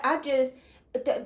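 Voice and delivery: ordinary delivery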